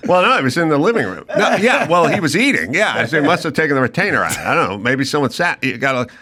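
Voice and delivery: rattled off quickly, x1.5; rushing back into the chat, talking fast; restarting the call in a quick babble; fast rebooted chatter. Retold or imitated. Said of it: "hear them reboot".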